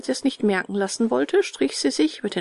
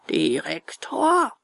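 slow, stretched gruff retort; gruff echo; 6 semitones higher, much slower than usual. A narrator's reading. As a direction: repeat the title gruffly, drawn out. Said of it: "gab dieser barsch zurück". (barsches, gedehntes Echo)

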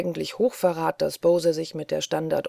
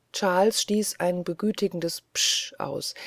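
ordinary delivery, nothing marked

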